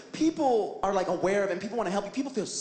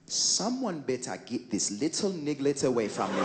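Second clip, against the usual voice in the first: put-on Trinidadian babysitter; 6 semitones lower than usual. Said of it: "The lady goes".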